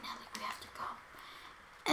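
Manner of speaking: hushed whisper to a sister; barely audible, unvoiced aside. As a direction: whisper it discreetly across a classroom. Calling sister quietly in class.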